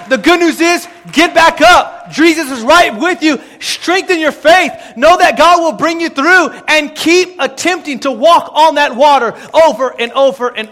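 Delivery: loud, driving sermon delivery; impassioned preacher in full flow; full-force preaching. Real, not acted.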